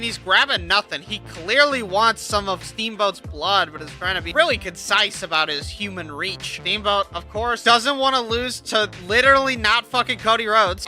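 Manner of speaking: usual speaking voice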